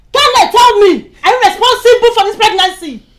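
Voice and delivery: mother demanding an answer from her son, loudly; angry demand. Acted. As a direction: force the truth out of him.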